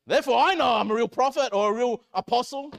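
loud, high mock bragging; sarcastic boasting. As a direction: crow it sarcastically as a false boast. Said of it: "like, being sarcastic".

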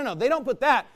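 usual speaking voice

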